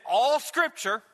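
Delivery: "All" stressed at the head of the phrase. Shown as a demonstration. Preacher reading the verse to the congregation, "All" emphasized. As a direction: lean hard on the word "All". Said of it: "I put the emphasis upon the word all".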